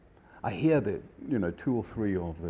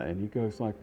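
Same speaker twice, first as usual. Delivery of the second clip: ordinary delivery, nothing marked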